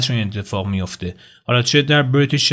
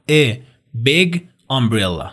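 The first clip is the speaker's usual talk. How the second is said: slow "a big umbrella", words kept apart; much slower than usual. Shown as a demonstration. Teacher saying it slowly before showing linking, very slow. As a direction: say "a", pause, then "big umbrella" unlinked and drawn out. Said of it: "اگه بخوام خیلی آروم بگم".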